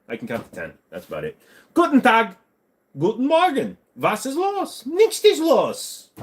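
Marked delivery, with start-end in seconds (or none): 1.7-6.1 high, hammed-up German accent; mock German. Streamer shows off his German to chat, high mock German accent. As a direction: play a cartoon German, pitched way up. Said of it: "try speaking German".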